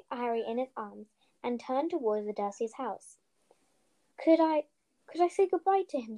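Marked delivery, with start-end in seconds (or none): 4.2-6.2 Hagrid pleading. A narrator's reading. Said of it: "asked Hagrid".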